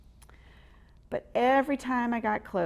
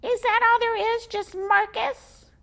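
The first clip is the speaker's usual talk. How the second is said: high, loud squeaky squirrel; exasperated little squirrel; 7 dB louder than usual. Acted. Squizzy exasperated with Marcus, squeaky.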